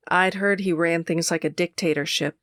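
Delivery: ordinary delivery, nothing marked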